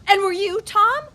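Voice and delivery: high, loud, cutting question; accusing. Acted. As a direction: throw it back in his face. Diana turns the accusation back.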